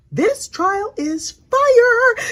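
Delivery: drawn-out, high, gleeful drawl; thrilled gossip hype; hyped. Real, not acted. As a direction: savor it, high and slow. Gushing over the gossip.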